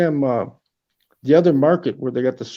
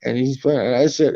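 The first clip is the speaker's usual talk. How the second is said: normal talking voice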